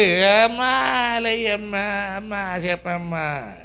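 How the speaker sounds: long, fading imitation of a grieving child; mock lament. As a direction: plead with a dead mother, mockingly. Imitating child wailing at mother's body.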